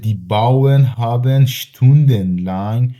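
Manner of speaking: teacher reading the example sentence slowly to students; slow careful reading. Shown as a demonstration. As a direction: read each word slowly and clearly for learners. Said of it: "نوشته".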